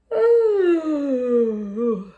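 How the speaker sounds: a loud high wailing cry-laugh; loud drawn-out comic wail; loud silly wail. Shown as a demonstration. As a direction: let out a big silly wail. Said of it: "making silly voices".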